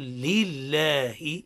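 لله drawn out very slowly, lam doubled and vowels clear; teacher leads the class, stretching لله; slow model recitation. Shown as a demonstration. Teacher models لله very slowly for the students. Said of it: "قول".

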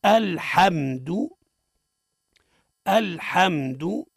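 الحمد very slowly, "al-ham-du", each sound separated; deliberate teacher's model of الحمد with held م; model pronunciation. Shown as a demonstration. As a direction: say الحمد syllable by syllable, open a, short final u. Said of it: "اسمعنا كيف ننطق به".

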